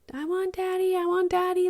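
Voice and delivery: high-pitched imitation of a wailing kid; mock child's scream. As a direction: mimic an inconsolable child calling for a parent. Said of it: "screaming for daddy".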